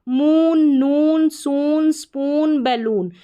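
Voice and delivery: "मून, नून, सून, स्पून, बैलून" with drawn-out oo; long oo demo. Shown as a demonstration. Teacher drills learners on double-o-n, long oo in "मून, नून, सून". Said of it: "बड़ा ऊ का उच्चारण है".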